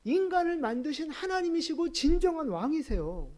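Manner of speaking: high, insistent protest; impassioned preaching. Real, not acted. Preacher insisting to congregation Jesus is truly God, impassioned. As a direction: plead the point with rising fervour.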